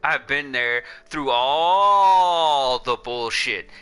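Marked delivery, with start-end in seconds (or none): 1.3-3.7 loud, long-drawn "all" in "all the bullshit"; emphatic stress. Real, not acted. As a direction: stretch "all" out hard and loud. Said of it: "say that again for the people in the cheap seats".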